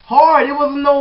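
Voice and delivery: loud, high punch on the word; Hard barked out high and loud; shouted emphasis. Real, not acted. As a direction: hit Hard like a slap. Describing violent self-slapping.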